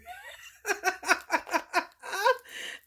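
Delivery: rising squealing laugh; high laugh. Real, not acted. Laughing at strangers running after her. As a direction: let a high laugh climb.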